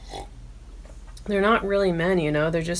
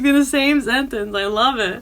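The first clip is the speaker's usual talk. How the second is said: normal talking voice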